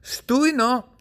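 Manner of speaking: usual speaking voice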